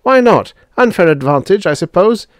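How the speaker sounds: high, loud, cutting retort; biting challenge; cutting edge. A narrator's reading. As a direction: throw it back sharply, pitched high. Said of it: "There was a cutting edge on the younger man's words".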